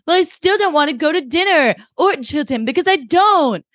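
high, petulant mock-teen refusal; moody teen. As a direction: imitate a daughter digging her heels in. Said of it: "they're both, like, in a fucking mood".

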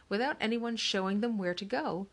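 ordinary delivery

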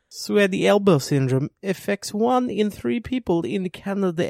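put-on upbeat old-timey commercial announcer; chipper 1950s ad. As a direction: sell it like a cheesy vintage ad. Fake sponsor ad read.

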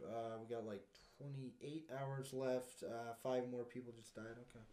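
soft, low, indifferent tallying; mock detached official; uncaring imitation. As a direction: report deaths like routine admin. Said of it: "they don't care".

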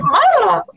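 high-pitched blurted "ah"; mock yell that shoots up high; runaway mouth noise. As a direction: let out a high, mindless "ah". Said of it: "my mouth is just like".

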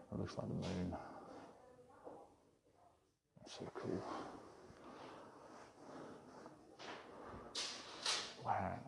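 hushed gallery whisper, muffled behind a mask. Real, not acted. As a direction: keep it low so staff don't hear. Whispering covertly inside gallery.

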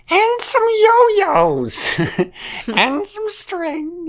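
very high, slow, wailing squeal; shrieking Mrs Potato Head. Retold or imitated. Mocking Mrs Potato Head packing for her husband, wailing squeal. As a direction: caricature her packing list as a crying wail. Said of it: "she yells a lot".

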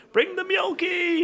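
high, drawn-out gleeful shout; sing-song mock enthusiasm; mock excited cry. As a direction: call it out like an overexcited picnicker. Mock cheer, excited picnic packing.

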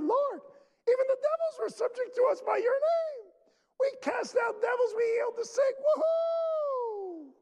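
very high-pitched, giddy boasting; excited disciples. Acted. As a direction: crow it at the top of your range, ending in a long whoop. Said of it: "Remember, they were excited".

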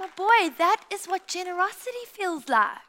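high and loud delighted exclaim. Retold or imitated. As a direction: burst with proud delight. Mom's delighted reply retold.

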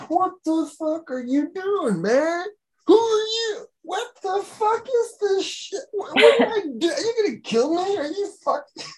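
very high, squealing, freaked-out questions; panicked wheelchair man. Retold or imitated. Man in a wheelchair in the basement confronting him, very high panicked squeal. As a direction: shriek it like he thinks he's about to be killed. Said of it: "he's going around going".

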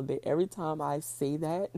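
ordinary talk

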